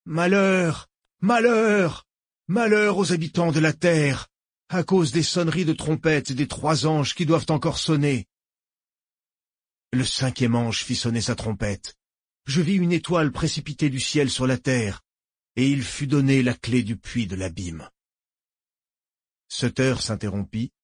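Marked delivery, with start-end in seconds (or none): 0.1-4.3 ringing cries of woe, higher; loud proclamation. A narrator's reading. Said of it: "proclamer d'une voix forte". 4.7-17.9 solemn, measured recitation; scripture recitation. A narrator's reading. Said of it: "récita".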